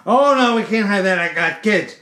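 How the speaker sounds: high, loud "oh no" easing down; mock-alarmed customer protest; retold alarmed objection. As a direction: object in alarm, then settle as you explain. Customer objecting, acted quote.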